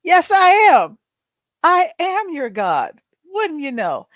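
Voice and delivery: high, put-on grand voice claiming to be a god; mock self-important explorer; pompous Captain Cook. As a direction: play the smug fake god. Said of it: "And he said".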